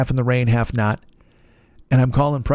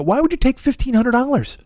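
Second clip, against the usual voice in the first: incredulous protest; 11 semitones higher than usual. Retold or imitated. Incredulous at deposit demand.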